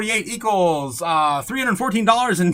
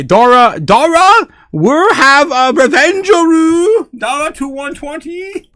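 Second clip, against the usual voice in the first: much higher and louder, slow hyped announcement; 9 semitones higher, 12 dB louder, slower than usual. Real, not acted. Streamer hypes chat about Dora hitting one twenty, excited. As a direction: shout the news like a big win. Hyped over the coin price jump.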